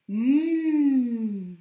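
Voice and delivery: «Mmh» tenu longuement, bouche fermée; son étiré. Shown as a demonstration. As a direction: faire durer le son «Mmh». Teacher sounds out the letter M. («Mmh» prolongé comme pour épeler)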